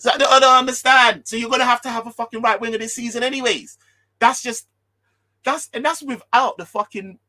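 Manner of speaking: loud, high, exasperated; can't believe what he's hearing, letting it rip; exasperated outburst. Real, not acted. Ranting to viewers about selling Salah, loud and exasperated.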